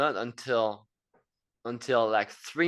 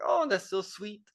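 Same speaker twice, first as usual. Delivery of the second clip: high charmed voice; 9 semitones higher than usual. Retold or imitated. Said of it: "you feel like".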